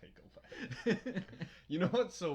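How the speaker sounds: high, slow laughing echo. Real, not acted. Repeating the line, laughing.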